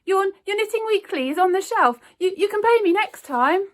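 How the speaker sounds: high, loud stammering nerves; shaking, stammering. A narrator's reading. Newsagent stammers to Miss Bennet from counter top, frightened. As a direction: stumble over words in fright. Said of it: "his voice shaking".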